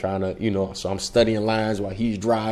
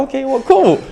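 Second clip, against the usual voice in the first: bright casting lady; 6 dB louder than usual. Retold or imitated. Casting woman confirming the time.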